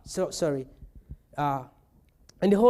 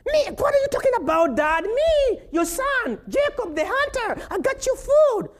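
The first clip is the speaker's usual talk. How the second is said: much higher, bewildered son's voice; high-pitched protest; 14 semitones higher, 3 dB louder than usual. Retold or imitated. Son protesting to his father, high bewildered voice. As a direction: play the baffled son in a squeaky voice.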